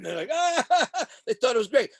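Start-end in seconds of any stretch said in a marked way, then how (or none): 0.3-0.9 very high mock whoop; imitated excited outburst. Mobsters loving his stories, imitated high excited cry. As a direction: mimic the pals' high, animated cry. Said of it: "they're all animated and jumping around".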